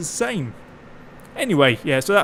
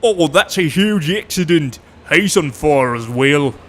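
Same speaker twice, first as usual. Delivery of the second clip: slow, louder laid-back commentator voice; deadpan Aussie commentator; 6 dB louder, slower than usual. Retold or imitated. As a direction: call a fiery crash like it's nothing, slowly. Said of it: "literally went".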